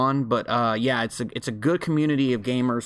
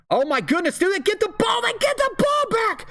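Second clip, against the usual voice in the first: high-pitched shriek of disbelief; real, unrestrained burst of high excitement; excited yelling. Real, not acted. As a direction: yell it out as the ball is snatched back. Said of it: "I can't yell".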